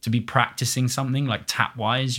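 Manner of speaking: ordinary talk, nothing marked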